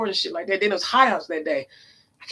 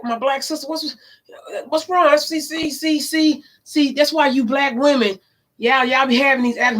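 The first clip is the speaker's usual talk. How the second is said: pushy man voice; 6 semitones higher than usual. Retold or imitated. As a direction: imitate a pushy street flirt. Imitating pushy man on street.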